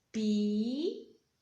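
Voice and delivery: slow, split into syllables pi-po-ca; syllables clapped. Shown as a demonstration. Teacher claps out Pipoca for the children, syllable by syllable. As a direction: clap out each syllable of Pipoca slowly. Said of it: "Vamos bater palma".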